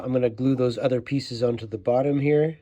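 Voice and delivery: ordinary delivery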